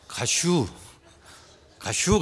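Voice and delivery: short Chungcheong quip, lightly imitated; terse Chungcheong punchline; Chungcheong dialect. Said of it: "충청도 사람 뭐라고".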